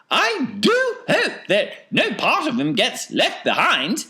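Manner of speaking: high eccentric falsetto; worried Wonka. A narrator's reading. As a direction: fuss aloud in a piping voice. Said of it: "said Mister Wonka".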